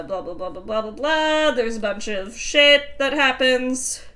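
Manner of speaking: ordinary delivery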